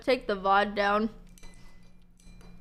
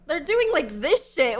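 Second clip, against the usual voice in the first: ordinary talk, nothing marked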